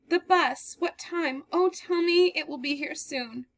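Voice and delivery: desperate for the bus to come; anxious pleading. Acted. Girl anxiously asking the man when the bus comes.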